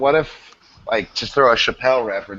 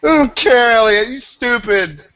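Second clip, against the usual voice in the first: higher, louder, hammed-up dismissive yell; play-shout it down at him like a bratty kid; mock indignant yell; 11 semitones higher, 5 dB louder than usual. Teasing a friend who claims the joke first, mock yell.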